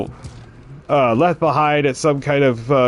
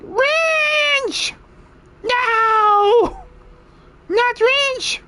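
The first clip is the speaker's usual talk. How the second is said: much higher, panicked Smeagol-style wail; Smeagol-like goblin; much slower than usual. Acted. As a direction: cry out for the lost wrench like a frantic goblin. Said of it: "He sounds like Smeagol".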